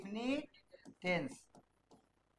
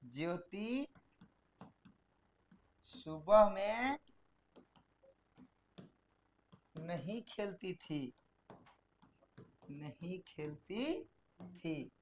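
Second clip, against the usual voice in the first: slow, soft, low dictation; dictating a practice sentence to students, slow and soft; 4 semitones lower, 8 dB quieter, much slower than usual. Shown as a demonstration. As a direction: read word by word for copying. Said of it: "practice के लिए sentence लेते हैं".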